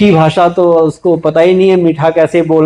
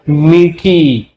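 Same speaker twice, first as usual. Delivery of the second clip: sweetly drawn out; 3 semitones lower, much slower than usual. Shown as a demonstration. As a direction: stretch "मीठी" very slowly, letting it linger sweetly. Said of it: "कैसी वाणी होनी चाहिए हमारी?".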